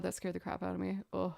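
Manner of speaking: ordinary delivery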